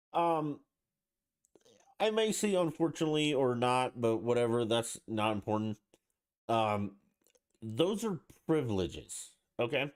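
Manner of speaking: rising agitation as the point builds; worked-up rant. Real, not acted. Podcaster getting fired up over rights, in a rising, heated voice.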